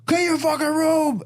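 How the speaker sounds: high-pitched imitated yell; mock furious parental order; angry dad. Dad who just smashed mirror, acted angry yell.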